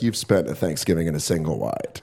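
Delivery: low, soft sneering judgment; judging onlooker. Pretend. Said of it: "you're like".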